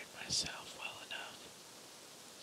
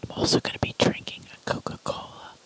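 whispering; 7 dB louder than usual. Real, not acted. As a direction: whisper close to the mic. ASMR eating video.

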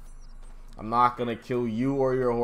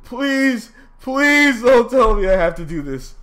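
loud, fast, higher begging; desperate pleading; 8 semitones higher, 7 dB louder than usual. Real, not acted. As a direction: beg the game not to make you redo it. Begging the game not to restart.

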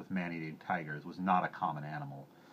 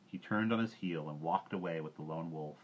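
usual speaking voice

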